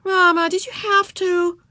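put-on high, slow childish whine; protesting. A narrator's reading. Ramona objects to waiting for Howie, high protesting child voice. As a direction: drag it out as a complaining little girl. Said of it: "protested Ramona".

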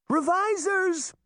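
high, slow, piercing scream. A narrator's reading. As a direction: scream the warning out. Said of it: "Daphne screamed".

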